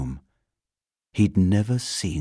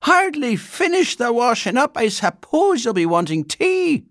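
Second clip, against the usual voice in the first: loud, high-pitched fluster; high, put-upon housekeeper in dismay; horrified; 7 dB louder than usual. A narrator's reading. Housekeeper with the washing up barely finished, horrified. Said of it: "she said in horror".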